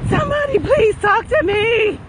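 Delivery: high, mock-desperate begging; playful plea. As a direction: beg the listeners for encouragement, hammed-up. Playfully begs for encouragement going uphill.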